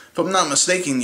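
ordinary talk, nothing marked

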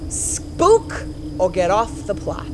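low, slow final warning; stern warning. Acted. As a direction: lay down the ultimatum slowly.

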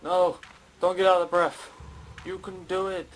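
urgent "you can do it!" rising each time; frantic cheering. Real, not acted. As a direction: cheer yourself on through the chase. Urging his character on in game.

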